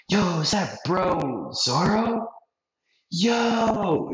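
slow, high, drawn-out hype; excited greeting. Retold or imitated. Pirate hunters spotting Zoro, excited and high. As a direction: call out to an old friend, stretched. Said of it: "they're like".

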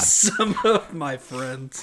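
very slow, drawn-out echo through laughter; teasing echo. Real, not acted. Friend teasing him over faint praise, slow laughing echo. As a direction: repeat it slowly, laughing at him.

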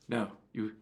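ordinary talk, nothing marked

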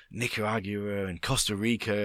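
usual speaking voice

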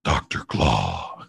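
very deep, slow throat-scraping villain; Doctor Claw. Shown as a demonstration. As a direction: growl it low and drawn out. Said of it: "pretty much his Doctor Claw voice".

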